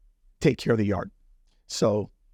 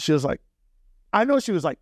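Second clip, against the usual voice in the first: usual speaking voice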